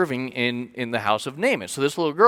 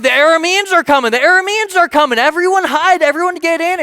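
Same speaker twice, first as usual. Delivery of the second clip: alarmed shout; 12 semitones higher, 7 dB louder than usual. Retold or imitated. Villagers spot the approaching army.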